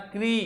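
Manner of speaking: क्री with a long clear "ee"; correct "kri". Shown as a demonstration. Teacher asks students to note the pronunciation, correct "kri". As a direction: say क्री as "kree". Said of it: "उच्चारण सन कर लेना".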